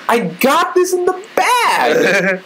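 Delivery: put-on high, drawn-out triumphant kid boast; cocky four-year-old. Kindergartner sure of mastering the numbers, slow high crowing. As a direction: crow it slowly like a victorious little kid. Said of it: "being a four-year-old kid in kindergarten".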